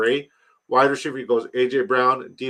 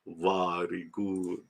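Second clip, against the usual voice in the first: soft, low mock Russian accent; slow, hushed thanks in a put-on Russian accent; hammed-up quiet Russian accent; 5 semitones lower, 12 dB quieter, slower than usual. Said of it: "your Russian's fantastic".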